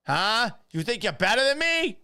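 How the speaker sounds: high, loud Boston challenge; mock-outraged Boston tough guy, voice shooting up; Boston accent. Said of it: "[name] doing a Boston accent".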